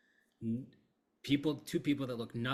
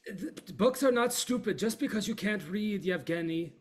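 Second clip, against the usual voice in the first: much higher, louder scolding voice; Yara scolding; 10 semitones higher, 8 dB louder than usual. Acted. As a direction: tell him off sharply, high and loud.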